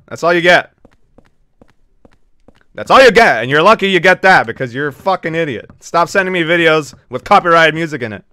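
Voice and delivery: loud exasperated rant; angry scolding. Real, not acted. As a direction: tear into them at full volume. Streamer scolding viewers over videos.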